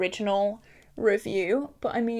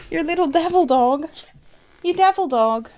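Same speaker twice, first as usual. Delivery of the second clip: higher, louder teasing at the dog; higher, louder playful scolding of the dog; mock scolding pet voice; 6 semitones higher, 6 dB louder than usual. Real, not acted. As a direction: playfully tell off the little devil.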